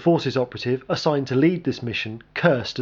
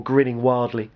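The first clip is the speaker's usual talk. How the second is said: plain reading voice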